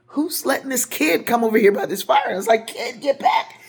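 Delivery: high, loud warning shout; alarmed shout. Retold or imitated. Warning a running figure away from the burning car, high alarmed shout. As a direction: yell to pull them back from the fire. Shouting at someone near fire.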